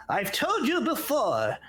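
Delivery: high, slow scolding halfling voice; tiny old gnome scolding the brawlers; stern little halfling. Acted. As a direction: scold them like a tiny elder. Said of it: "And then he says".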